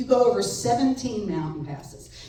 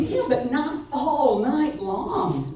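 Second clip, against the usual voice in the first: drawn-out exasperation; 6 semitones higher, 4 dB louder, much slower than usual. Retold or imitated. Retold reply to mom.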